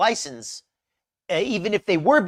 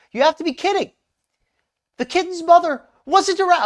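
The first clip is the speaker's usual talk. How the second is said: higher, louder mock indignation; mocking voice of Foodie; 11 semitones higher, 5 dB louder than usual. Shown as a demonstration. Vlogger reading Foodie's post in her voice, hammed up. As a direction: read it like an outraged poster. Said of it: "I'm gonna read it in her voice".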